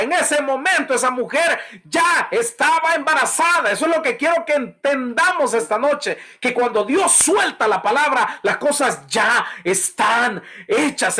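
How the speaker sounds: fiery preaching. Acted. Pastor urging the congregation in a fiery sermon. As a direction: preach it out high and hard to the crowd.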